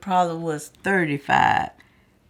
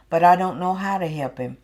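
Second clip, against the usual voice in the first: ordinary talk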